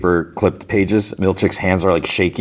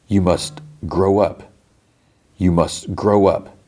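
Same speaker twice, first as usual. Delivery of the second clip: slow, deliberate incantation with measured pauses; disturbing self-admonishment; slower than usual. Retold or imitated. Man admonishing his own reflection, slow and disturbing. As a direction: repeat it like a stern mantra. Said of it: "this part was a little disturbing".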